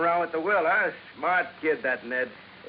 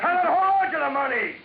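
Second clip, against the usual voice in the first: high, loud accusing shout; angry shout; 11 semitones higher, 3 dB louder than usual. Acted. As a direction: throw the accusation at him in a rage. Heated quarrel, accusing the nephew.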